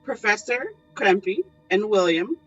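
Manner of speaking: ordinary talk, nothing marked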